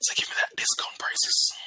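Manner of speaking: breathy hushed request; whispering. Real, not acted. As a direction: whisper it to the chart. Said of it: "Why are you whispering like I C T?".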